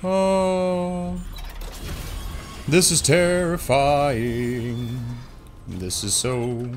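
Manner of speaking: very slow, stretched words; drawn-out dread. Real, not acted. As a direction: savour the fear word by word. Creeping through dark horror area.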